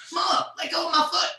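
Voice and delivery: high, loud yelp; mock yell. Son pinned in an ankle lock yelling at mom: high loud yelp. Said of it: "These days I'd be like".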